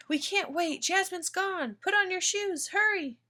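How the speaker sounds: high, urgent screaming mother. A narrator's reading. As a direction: scream at the child to hurry. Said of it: "Mama's screaming at me".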